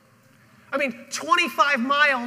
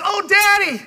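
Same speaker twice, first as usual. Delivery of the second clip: joyful shout; 8 semitones higher, 9 dB louder than usual. Acted. Healed son greets father.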